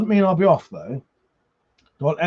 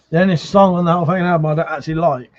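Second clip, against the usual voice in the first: ordinary delivery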